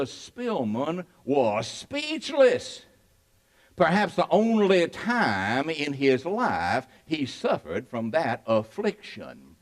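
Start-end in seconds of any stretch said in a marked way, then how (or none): none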